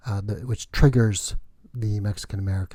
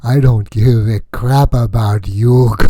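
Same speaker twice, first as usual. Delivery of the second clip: mock angry wife; 13 dB louder than usual. Quoting angry wife Lilith.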